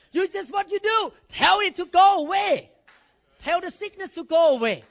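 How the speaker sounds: high, loud preaching; forceful command. Real, not acted. Preacher urging the church to order sickness away, loud. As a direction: demand it with force. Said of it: "You demand it".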